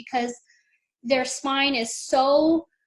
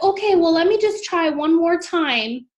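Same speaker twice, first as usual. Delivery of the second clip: coaxing doctor; 6 semitones higher, 4 dB louder than usual. Retold or imitated. Doctor voiced pleading for another attempt.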